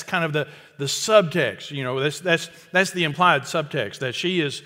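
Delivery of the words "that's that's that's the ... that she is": normal talking voice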